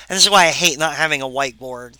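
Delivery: ordinary talk, nothing marked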